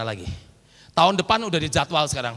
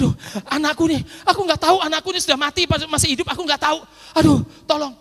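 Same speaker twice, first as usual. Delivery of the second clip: frantic father; 8 semitones higher, 3 dB louder than usual. Retold or imitated. Father fears child is dead.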